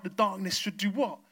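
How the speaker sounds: ordinary talk, nothing marked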